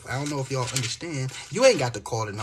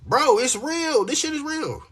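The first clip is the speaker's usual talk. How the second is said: 1 insistent; 13 semitones higher, 4 dB louder, slower than usual. Real, not acted. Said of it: "insisting the scripture is real".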